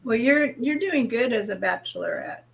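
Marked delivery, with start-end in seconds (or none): none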